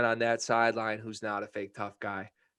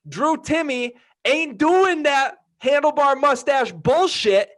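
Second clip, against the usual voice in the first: raised, high-pitched emphatic tirade; heated rant; 9 semitones higher, 7 dB louder than usual. Real, not acted. Podcast host calling out Drew Timme for fake toughness, heated rant. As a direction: build into a loud, punchy call-out. Trash-talk rant at rival fans.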